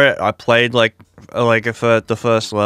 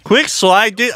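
excited shout; 12 semitones higher, 4 dB louder, slower than usual. Real, not acted. Excited repeat of callout.

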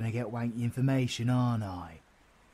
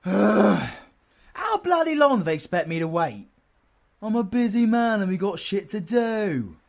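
impatient grumbling; 8 semitones higher, 10 dB louder than usual. Acted. Impatient waiting for late contact.

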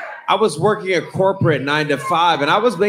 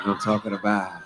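dropped low, a touch quieter; lowered voice; 7 semitones lower, 6 dB quieter, much faster than usual. Shown as a demonstration. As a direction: sink the voice down a little. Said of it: "I like to lower my voice".

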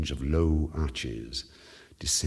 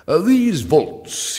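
drawn-out, louder and higher; slow loud drawl; 11 semitones higher, 11 dB louder, much slower than usual. A narrator's reading. Fortunato marvelling at the vaults, slow and loud. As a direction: stretch each word in awe. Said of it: "he said".

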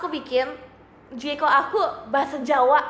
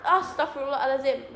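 ordinary talk

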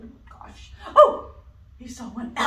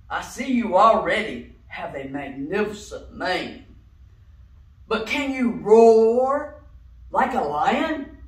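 lion voice; 10 dB louder than usual. Acted. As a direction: speak like a proud, booming lion. Lion character speaks.

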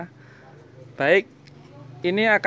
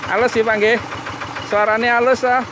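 loud, raised pitch; calling over engine noise; 6 semitones higher, 7 dB louder, faster than usual. Real, not acted. Mechanic checking the smooth sound with the owner, calling out loudly. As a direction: project over the running motor.

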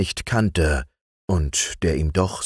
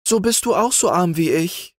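put-on higher girl's voice, plaintive and lightly tearful; lamenting girl; 12 semitones higher than usual. A narrator's reading. Weeping maid laments to the youth, higher and plaintive. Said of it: "klagte das Mädchen".

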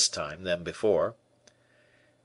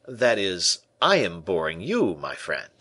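ordinary delivery, nothing marked

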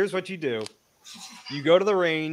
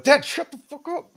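much higher mock-exasperated voice; imagined son's outburst; 11 semitones higher, 3 dB louder than usual. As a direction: imitate a kid yelling at Dad. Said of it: "if he was, be like".

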